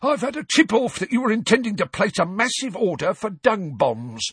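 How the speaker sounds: high, loud, accusing caretaker; angry Filch. Acted. Caretaker Filch accusing Harry, high angry voice. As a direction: bark it like a suspicious old caretaker.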